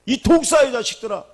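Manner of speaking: loud, high rebuke hitting 독사의; Jesus rebuking the crowd, loud and high. Retold or imitated. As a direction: thunder it as a rebuke. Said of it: "막 그랬잖아요".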